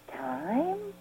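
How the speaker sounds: a soft, hushed one-word question; murmured doubtful echo; quiet disbelief. Retold or imitated. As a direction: let it drop out almost breathless, stunned. He looked at me, said.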